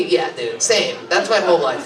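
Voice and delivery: ordinary talk, nothing marked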